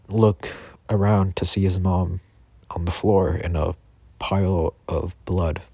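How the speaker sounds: ordinary delivery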